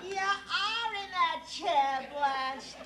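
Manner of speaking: slow, drawn-out taunt; mocking retort. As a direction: drag it out, mocking and cruel. Mocking Crawford's line back.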